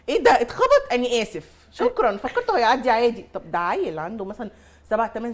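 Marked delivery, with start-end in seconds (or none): 0.1-3.2 high, loud fake surprise; mock shocked supervisor. Mother mimicking the careless bus supervisor feigning surprise. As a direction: sound falsely shocked at the news.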